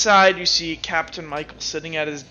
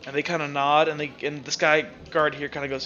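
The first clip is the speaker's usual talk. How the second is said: usual speaking voice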